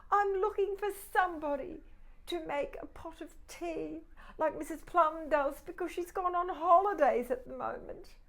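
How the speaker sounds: high quick teapot voice. Acted. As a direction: explain it eagerly, a little fast. Teapot explains to cat.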